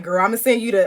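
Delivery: ordinary delivery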